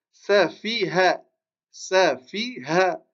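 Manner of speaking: Safiha drawn out, each syllable clear; teacher modelling Safiha slowly; slow careful reading. Shown as a demonstration. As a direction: sound out Safiha slowly for the class. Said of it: "Le mot d'après".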